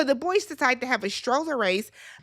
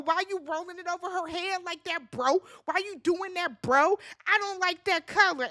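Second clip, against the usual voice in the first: mock nagging; 6 semitones higher than usual. [name] fussing at Chris while he changes the baby, mock nagging. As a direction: mimic someone picking at every little thing.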